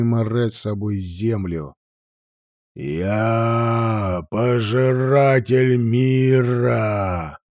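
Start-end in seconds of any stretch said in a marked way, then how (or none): 2.8-7.4 slow, drawn-out dragon roar; a lightly voiced dragon bellowing its title; huge fiery dragon roar. A narrator's reading. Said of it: "заревел дракон". (медленный рёв огромного огненного дракона)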